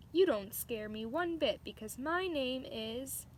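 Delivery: loud, bold and defiant; bold declaration. A narrator's reading. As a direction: stand tall and dare the world. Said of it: "draw yourself up inside and say".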